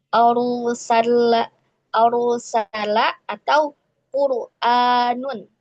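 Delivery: arsala and qur'anun drawn out, ra heavy; slow recitation example. Shown as a demonstration. Teacher reciting examples of heavy sukun ra slowly. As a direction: recite slowly, letting the ra sound thick. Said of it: "Itu seperti".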